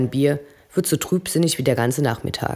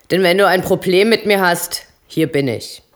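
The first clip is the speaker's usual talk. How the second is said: higher, loud challenge; threatening; 5 semitones higher, 6 dB louder than usual. Acted. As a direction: square up and dare him. Eddie droht Obob. (laute, drohende Herausforderung)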